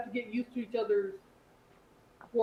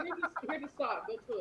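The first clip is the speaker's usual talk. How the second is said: mock offer; 5 semitones higher, 6 dB quieter than usual. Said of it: "I'd be like".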